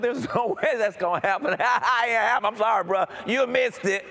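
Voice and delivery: laughing dismissal. Retold or imitated. Young man to prophet, laughing high loud dismissal.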